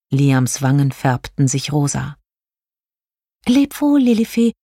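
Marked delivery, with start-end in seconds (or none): 3.5-4.5 high-pitched, apologetic fairy voice; high farewell plea; pleading star fairy. A narrator's reading. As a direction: beg pardon sweetly, voice pitched high. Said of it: "bat Jelina".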